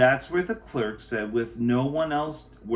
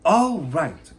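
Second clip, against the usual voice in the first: loud, higher exclamation from the mayor; mayor's cry; 5 semitones higher, 9 dB louder than usual. Acted. Mayor agreeing to Sofia's plan, crying out loudly. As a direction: call it out with sudden approval. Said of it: "cried the mayor".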